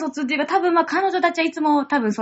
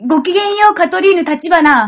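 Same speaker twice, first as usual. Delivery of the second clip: higher, louder grand-lady greeting; haughty rich girl japanese; 5 semitones higher, 7 dB louder than usual. Acted. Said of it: "できる？". (上品ぶったお嬢様の挨拶)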